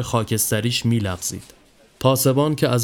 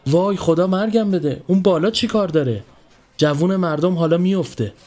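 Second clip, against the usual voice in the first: high, alarmed cry of dread; alarmed woman; 7 semitones higher than usual. A narrator's reading. Woman in a chador seeing the man up the tree, alarmed and high. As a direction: gasp it out as if he'll fall any second. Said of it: "دوید و وقتی مرد را بالای چنار دید".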